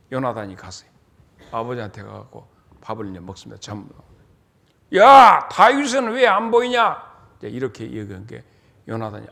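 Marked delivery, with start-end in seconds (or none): 5.0-7.0 loud, higher angry demand; a furious father barking across the table; sudden loud roar of a king; angry king's bark. Acted. King Saul demands at dinner.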